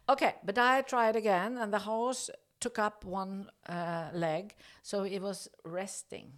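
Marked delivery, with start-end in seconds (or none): none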